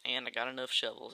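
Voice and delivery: put-on slow, drawling hillbilly accent; easygoing hillbilly accent, half-conscious. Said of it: "why am I talking in this hillbilly accent".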